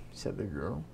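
ordinary delivery, nothing marked

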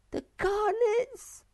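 very high, slow wailing moan; Sniff. A narrator's reading. Sniff sobs over lost treasure, moaning. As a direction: moan it out in a tiny tearful voice. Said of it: "Sniff moaned".

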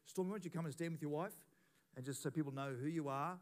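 ordinary delivery

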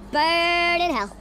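slow, sustained damning growl of words; long, slow, venomous send-off; drawn-out curse. Real, not acted. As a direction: stretch it out like a curse. Cursing at the game.

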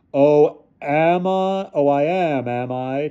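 high, eerie, taunting questions; mocking challenge. A narrator's reading. Figure answers Ludwig's defiance, mocking. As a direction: toy with the doubter.

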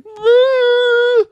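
very high, loud fake sob; mock crying wail. Student mimics guy crying on demand, exaggerated wail. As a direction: imitate instant bawling in one long squeal. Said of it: "dude cries on demand".

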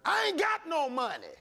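mocking the complaining churchgoer; mock complaint. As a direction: act out the broke complaint.

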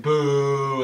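a loud imitated boo from the crowd; mock crowd boo. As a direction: boo him like the whole arena. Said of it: "everybody's like".

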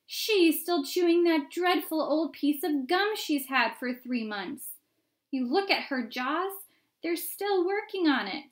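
high, loud gossiping crowd voice; shouting back. A narrator's reading. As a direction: shout the gossip over the noise. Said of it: "somebody else shouted back".

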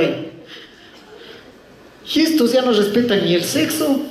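usual speaking voice

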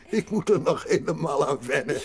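high, loud laugh through the words; laughing grandpa. Acted. Grandpa laughing at himself to Heidi. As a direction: chuckle it out embarrassed.

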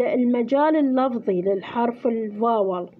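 ordinary delivery

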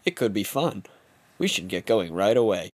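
usual speaking voice

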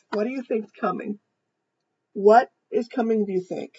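ordinary delivery

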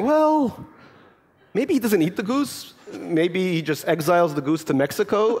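high mock-Russian granny; grandmother's gentle correction. Grandmother voiced in story.

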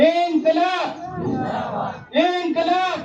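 high rallying shout, drawn out; slogan call. Real, not acted. Rally speaker calls for the crowd's reply, rousing slogan shout. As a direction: throw the cry out for a crowd to answer.

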